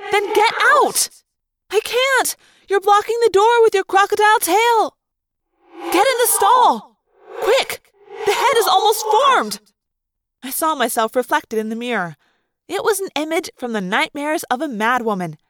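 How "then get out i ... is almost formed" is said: high, loud, frantic exchange; panicked shouting. Acted. Friends trapped with an emerging crocodile, panicked shouting. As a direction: shout in rising desperation.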